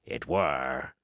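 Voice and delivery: low, rolling sea-cook drawl; old pirate. Acted. Old sea cook boasting to the crew, low and rolling. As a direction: spin yarns like a scheming old pirate.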